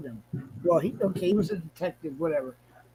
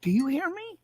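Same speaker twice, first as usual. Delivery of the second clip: imitating the cop; 10 semitones higher, 3 dB louder than usual. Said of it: "He looks at me".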